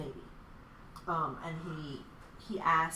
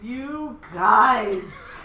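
drawn-out, loud, higher gushing; mock touched; 5 semitones higher, 12 dB louder than usual. Host to her friends after their thanks, mock touched. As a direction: gush it out loudly, hammed-up.

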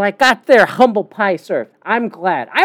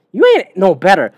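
ordinary delivery

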